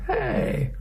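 very high, soft little "hey"; protesting daughter. Acted. Daughter whose ice cream dad is scooping, high-pitched protest. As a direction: squeak out a girl's small protest.

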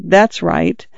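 usual speaking voice

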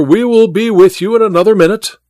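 high-pitched reassuring call; calling through a door. A narrator's reading. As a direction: call to someone behind a closed door. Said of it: "said Welton through the door".

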